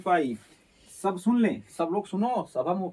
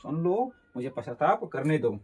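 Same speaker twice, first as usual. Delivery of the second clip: ordinary talk, nothing marked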